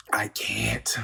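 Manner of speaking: exasperated whisper; whispered I can't. Real, not acted. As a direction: breathe it out, defeated. Exasperated giving up.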